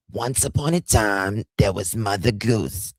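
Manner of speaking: a hammed-up hint of a bedtime story; mock bedtime story. Mocking Janie as a bedtime storyteller, sing-song storybook voice. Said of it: "she would be great to do A S M R bedtime stories".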